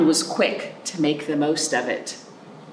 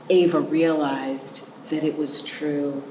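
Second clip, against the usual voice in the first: ordinary delivery, nothing marked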